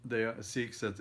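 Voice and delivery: normal talking voice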